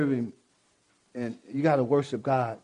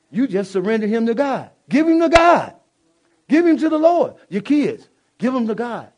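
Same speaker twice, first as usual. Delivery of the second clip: high, loud preaching call; 7 semitones higher, 7 dB louder than usual. Real, not acted. Preacher urging the church to give all to God. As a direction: cry it out to the congregation.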